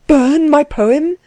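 high, drawn-out outraged question; indignant disbelief. A narrator's reading. Klutz hears Axel would burn his poem, indignant disbelief. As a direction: stretch the words out, aghast. Poet indignant at suggested burning.

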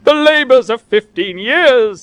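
loud, high, drawn-out wail; slow cry of despair; anguished lament. A narrator's reading. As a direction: mourn a life's work theatrically. Old man laments his ruined work.

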